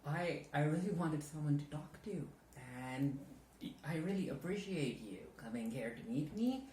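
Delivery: confiding monologue. Acted. Actor confides in scene partner about a ghosting, hesitant and hurt. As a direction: open up hesitantly, pausing for the listener's replies. Show you the monologue that I came up with.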